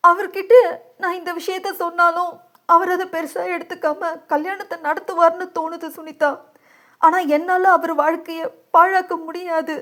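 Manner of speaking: high, plaintive tearful voice; despairing and helpless, pitched high; distraught. A narrator's reading. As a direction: confide through tears. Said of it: "பித்து பிடிக்கும் நிலை".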